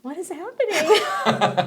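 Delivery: slow, high-pitched disbelief; retold bewilderment. Passerby seeing tents in the cold, baffled. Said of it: "and I was like".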